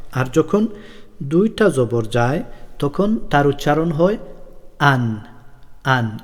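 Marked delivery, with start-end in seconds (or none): 4.8-6.2 আন with a clear nasal n ending; tanween an sound. Shown as a demonstration. Teacher shows children the double-jabar sound, clearly. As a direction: sound out আন, closing on the n. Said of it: "এভাবে".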